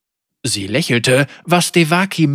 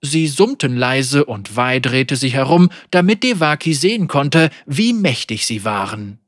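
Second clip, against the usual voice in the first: ordinary delivery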